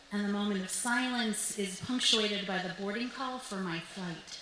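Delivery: ordinary talk, nothing marked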